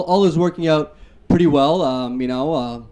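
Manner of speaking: usual speaking voice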